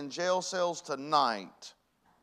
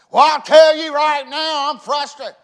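high loud slowed mock rant; a husband shouting out his frustration; angry husband; 10 semitones higher, 16 dB louder than usual. Husband snapping back at a loving wife, loud and high.

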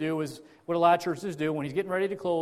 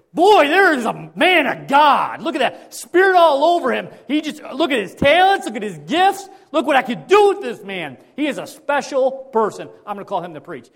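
mock-admiring God voice; 8 semitones higher, 13 dB louder than usual. God admiring a gifted preacher, hammed-up grand praise. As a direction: boom out admiration like a proud showman.

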